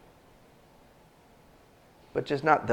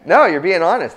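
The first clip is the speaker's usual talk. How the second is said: louder, higher laughing reply; 4 semitones higher, 10 dB louder than usual. Real, not acted. Pastor reacting to the congregation's honest answer, laughing. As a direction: laugh through the words, amused.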